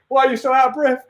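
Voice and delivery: high put-on voice, questioning; suspicious copper. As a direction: quiz him like a nosy cop. Acted jogger stopped by officer.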